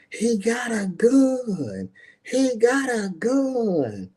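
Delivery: high, slow drawn-out imitated scream; retold scream. Family member screaming to the crowd that he's armed, imitated high scream. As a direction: mimic a woman shrieking a warning at a crowd. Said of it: "she screamed at the top of her lungs".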